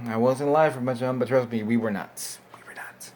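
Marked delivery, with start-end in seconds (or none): 2.5-3.1 breathy whisper, hardly voiced; whispered aside. Shown as a demonstration. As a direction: whisper it to yourself. Repeats the phrase as an aside.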